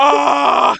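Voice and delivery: a sudden, loud scream of terror from a frightened character; panicked scream. Acted. Deadbolt screams in terror at the slime, a loud panicked cry. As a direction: shriek like you've seen a monster. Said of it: "Deadbolt is screaming bloody murder".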